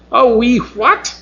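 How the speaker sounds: disdainful high rising question; sniffy. Acted. Mavis looks down on Dell's dialect, sniffing. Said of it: "Mavis sniffed".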